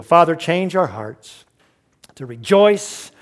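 soft, unhurried pastoral prayer; closing prayer. Acted. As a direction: pray it quietly and earnestly over the church.

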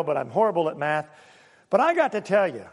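usual speaking voice

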